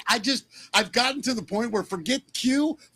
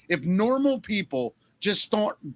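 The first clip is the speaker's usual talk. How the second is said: ordinary talk, nothing marked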